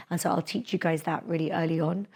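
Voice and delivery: usual speaking voice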